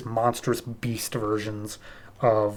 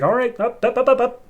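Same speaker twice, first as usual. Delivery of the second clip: much higher, rushed hands-up pleading; panicky placating; 11 semitones higher than usual. Retold or imitated. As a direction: squeak out the ups to stop her. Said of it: "He's like".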